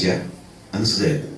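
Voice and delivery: normal talking voice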